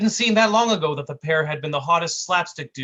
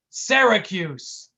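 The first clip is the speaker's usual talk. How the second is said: slow emphatic; 5 semitones higher, 5 dB louder, much slower than usual. A narrator's reading. Brick spells it out slowly.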